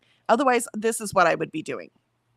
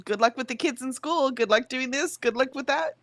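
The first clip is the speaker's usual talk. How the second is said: sarcastic; 6 semitones higher than usual. Pretend. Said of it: "That's what I would be like".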